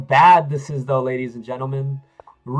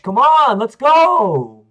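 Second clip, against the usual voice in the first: loud, high urging yell to himself; frustrated hype; 7 semitones higher, 8 dB louder, much slower than usual. Real, not acted. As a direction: pump yourself up, loud and high. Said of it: "I'm so frustrated with myself".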